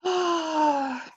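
long, high voiced sigh; high groaning sigh; exasperated sigh. Real, not acted. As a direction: let out a drawn-out exasperated sigh. Exasperated at the actor's accent.

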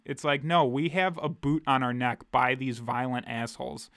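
usual speaking voice

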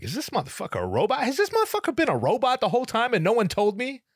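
very high, rapid incredulous rant; hammed-up inner freak-out; mock outrage. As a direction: blurt out your disbelief, voice shooting up. Mock shock at robotic greeting.

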